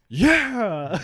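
loud, high imitated cheer; retold triumphant yell. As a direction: shout like a kid celebrating a score. Kid celebrates after belly flop.

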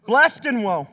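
preacher repeating Blessed and woe with force; Blessed and woe punched loud. Shown as a demonstration. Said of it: "Prophets had two favorite words".

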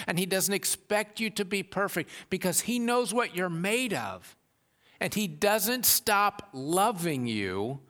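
high, loud, impassioned preaching. Acted. Preacher pleading with the congregation about grace, high and loud. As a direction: build it up and drive it home.

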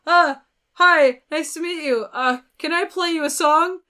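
high, loud starstruck greeting; mock nervous squeak; nervous freak-out. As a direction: act out a flustered fan introduction. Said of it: "a tiny bit freaking out".

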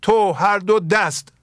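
measured Persian verse recitation, lingering on each word; poem recitation. Acted. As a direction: intone the couplet slowly like classical poetry.

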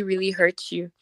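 normal talking voice

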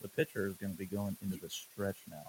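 low, hushed coach's aside; mock confidential murmur. First base coach advising a runner, mock confidential murmur. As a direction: murmur it softly, like secret advice in an ear. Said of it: "whisper in your ear".

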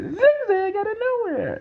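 high, slow excited commentator call; imitating Jerry Lawler. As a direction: stretch it out like a hyped ringside announcer. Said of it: "he said".

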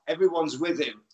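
ordinary talk, nothing marked

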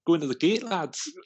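high-pitched Geordie imitation; Geordie accent. Friend quoting a catchphrase they always used, Geordie accent. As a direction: put on a high, playful Geordie voice. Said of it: "I'm gonna go Geordie".

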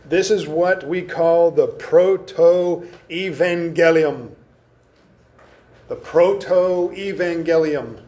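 "proto-evangelium" drawn out part by part; sounding out slowly. Shown as a demonstration. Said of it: "Can you hear the words in there?".

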